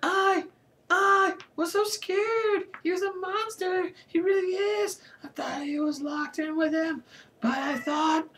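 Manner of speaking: very high, halting, frightened wail; high-pitched frightened whimpering; out of breath. A narrator's reading. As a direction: squeal it haltingly, scared. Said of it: "still out of breath".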